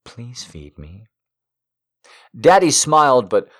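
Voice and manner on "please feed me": quiet, slow begging; soft slow plea. Acted. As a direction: plead softly and slowly.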